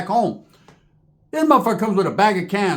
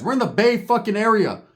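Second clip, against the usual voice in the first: ordinary talk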